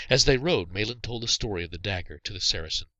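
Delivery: usual speaking voice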